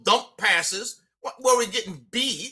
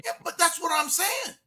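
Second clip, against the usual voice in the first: insistent yell; 8 semitones higher than usual. Real, not acted. Insistent reply in a debate.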